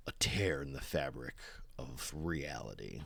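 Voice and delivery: soft, low, ominous whisper. Acted. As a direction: breathe it like a dark reveal. Dramatic story reveal.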